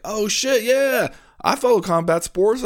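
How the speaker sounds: high, eager bro voice; excited young guy. Retold or imitated. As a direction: pitch it up like an eager fan. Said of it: "they'll be like".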